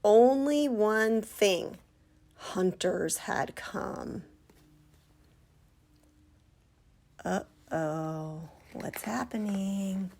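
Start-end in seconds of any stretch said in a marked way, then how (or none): 2.4-10.1 much softer, slow and hushed; hushed suspense; ominous hush. Acted. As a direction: drop to a scared hush. Ominous hunters arrive.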